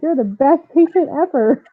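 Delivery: retold gush of praise; mock-enthusiastic, lifted voice. Nurses gushing over easy patient.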